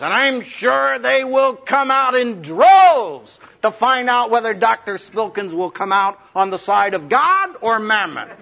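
slow, high sarcastic drawl; mock the title slowly. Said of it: "in typical sarcastic fashion says".

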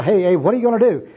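high, loud protest; mock-alarmed interruption; acted alarm. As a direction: break in, playing startled. Bum alarmed by pressing evangelist.

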